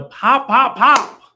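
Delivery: loud, high, hyped pop pop; excited shout. Acted. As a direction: punch it like a big reveal. Hyped merch reveal.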